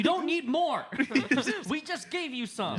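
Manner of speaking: high, loud mock exasperation; exasperated. Coworker refusing the scientist's demand, loudly exasperated.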